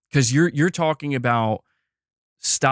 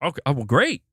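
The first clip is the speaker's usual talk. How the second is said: mock relieved Sony; 5 semitones higher than usual. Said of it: "They would have been like".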